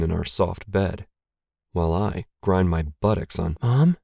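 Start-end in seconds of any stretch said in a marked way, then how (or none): 3.6-4.0 higher, louder one-word question. A narrator's reading. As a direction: call out a startled question, pitched up. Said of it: "A soft voice coming from somewhere".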